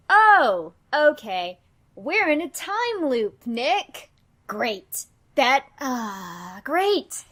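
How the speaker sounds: loud, sarcastic dismay; exasperated realisation. Acted. Man telling his partner of the time loop, loudly exasperated. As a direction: let it dawn loudly, then sour into sarcasm.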